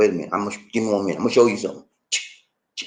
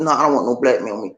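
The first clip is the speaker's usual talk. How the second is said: imitating Joe; 6 semitones lower, faster than usual. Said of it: "Joe said".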